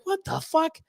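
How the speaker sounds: high, stunned mock disbelief. Reacting again to his buddy's boast, high mock disbelief. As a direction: squeak out shocked disbelief. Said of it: "And I'm like".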